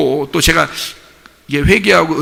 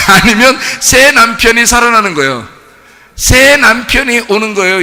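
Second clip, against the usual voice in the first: fiery preaching; 7 semitones higher, 6 dB louder than usual. Acted. Pastor proclaiming to the congregation, loud fiery preaching. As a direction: proclaim it to the congregation with full force.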